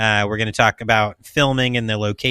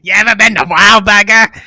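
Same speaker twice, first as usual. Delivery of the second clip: put-on Boston accent; 9 semitones higher, 11 dB louder than usual. Continuing Wahlberg impression.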